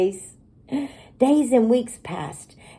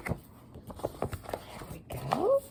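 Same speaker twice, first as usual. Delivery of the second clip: soft breathy aside; whisper; 11 semitones higher, 15 dB quieter than usual. Real, not acted.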